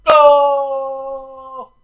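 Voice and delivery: high, loud soccer announcer yell. Shown as a demonstration. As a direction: belt it like a goal call. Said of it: "I sounded more like a soccer announcer".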